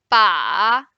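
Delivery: long, loud dipping "ba"; third tone. Shown as a demonstration. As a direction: draw "ba" down and back up. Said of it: "Let's try again".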